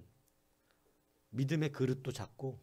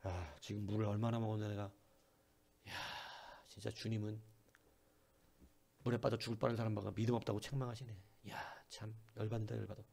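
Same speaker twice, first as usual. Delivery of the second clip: softer, sour muttered complaint; sulky grumbling; 3 semitones lower, 6 dB quieter than usual. Acted. Peter sulking after being rebuked, a soft sour grumble. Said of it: "베드로가 삐져가지고".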